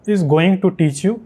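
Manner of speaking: slow Indian English, every word separate; normal Indian accent. Shown as a demonstration. Teacher showing how Indians normally say it, Indian accent. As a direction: say it in an Indian accent with a full "too". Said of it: "normally जैसे हम बोलेंगे हम लोग Indian में".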